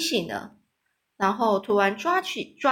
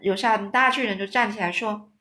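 ordinary delivery, nothing marked

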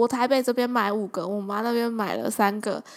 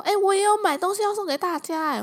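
higher boss voice, cheerful; 9 semitones higher than usual. Retold or imitated. Boss telling the team he bought gifts too. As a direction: announce it like an eager boss.

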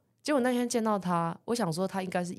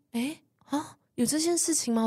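mock surprise; 8 semitones higher than usual. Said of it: "他就说".

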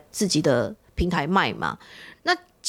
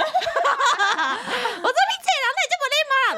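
very high, stretched sarcastic jab, laughing; laughing mock retort; slower than usual. Seller bickering with the caller, high laughing sarcasm. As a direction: snap back sarcastically, half laughing. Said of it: "我说".

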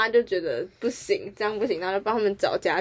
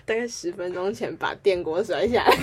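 ordinary talk

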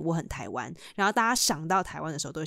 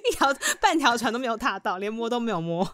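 high, louder, indignant protest; laughing protest; 5 semitones higher, 4 dB louder than usual. Real, not acted. Protesting to the host that she isn't on either boat, loud and high. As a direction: push back hard but laughing.

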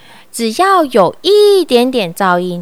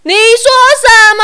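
high, loud bellowed question; loud roar; 11 semitones higher, 7 dB louder, faster than usual. A narrator's reading. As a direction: roar it so the whole shop hears. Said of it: "又大吼一声".

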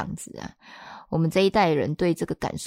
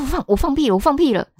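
higher, louder burst of good news; excited news; 9 semitones higher, 6 dB louder, faster than usual. Retold or imitated. Daughter waking her mom to announce the news, excited. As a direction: blurt it out thrilled.

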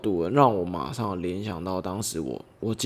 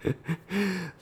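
short laugh; 9 semitones higher, 6 dB quieter than usual. Real, not acted. Laughs at own joke.

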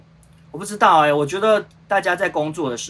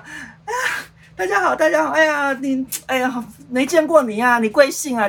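much higher, loud, gushing hello to strangers; over-the-top cheerful street greeting; mock overfriendly greeter; 12 semitones higher, 5 dB louder than usual. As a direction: ham up a grinning glad-hander on the street. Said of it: "也不会这样子啊".

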